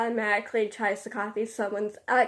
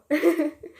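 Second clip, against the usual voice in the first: short nervous laugh; 5 semitones higher than usual. Real, not acted. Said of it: "laughs after coming out".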